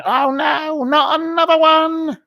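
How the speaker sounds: high, slow exasperated groan of a weary voter; Brenda from Bristol. Retold or imitated. Imitating Brenda from Bristol, who is fed up with another election, in a high exasperated voice. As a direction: mimic a fed-up Bristol woman hearing about another election. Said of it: "Brenda from Bristol ... going".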